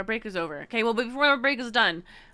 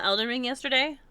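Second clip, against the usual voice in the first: normal talking voice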